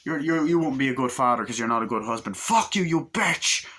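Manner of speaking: angry husband. Retold or imitated. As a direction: yell it at the wife across the table. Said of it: "He's like".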